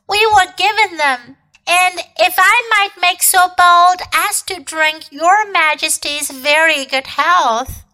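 high-pitched pleading fox; timid, high fox voice; deferential fox. A narrator's reading.

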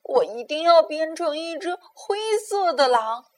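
hurt determination. Acted. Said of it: "一边跑一边说".

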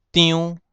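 张 as "tiunn", high level and nasal; Hokkien reading. Shown as a demonstration. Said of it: "念成张".

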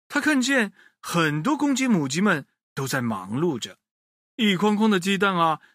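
ordinary delivery

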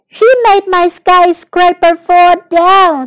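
put-on high, loud, slow childish complaint; drawn-out cross wail of blame; upset accusation. A narrator's reading. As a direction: tattle loudly like a wronged little boy. Oliver blames Amanda for collapse.

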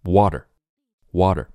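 "Water" with the t softened to a weak d; unaspirated T. Shown as a demonstration. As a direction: say "water" as "wah-der", no puff on the t. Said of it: "类似于一个弱弱的的的音".

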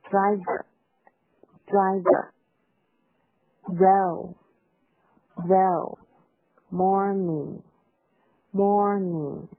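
driver, row, morning very slow, each vowel held full; full, rounded pronunciation. Shown as a demonstration. Teacher drills moms on fuller sounds, slow and drawn out. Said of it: "发音不够饱满的音呢".

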